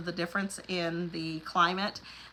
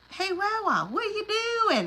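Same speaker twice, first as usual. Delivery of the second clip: imitating a granddaughter's twangy Southern accent, pitched high; high, sing-song twangy Southern toddler greeting; twangy little girl; 13 semitones higher than usual. A granddaughter calling to Wawa, high twangy Southern accent. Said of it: "she has such an accent, like, really twangy".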